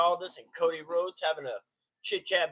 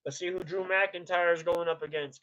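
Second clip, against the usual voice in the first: ordinary talk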